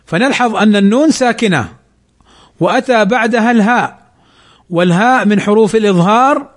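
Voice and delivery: ordinary talk, nothing marked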